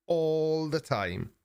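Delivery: "All" held long and high, weary emphasis on the repeat; drawn-out emphatic repeat. Real, not acted.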